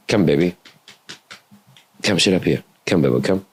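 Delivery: coaxing the dog. Real, not acted. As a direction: coax her gently up beside you. Calling the dog up.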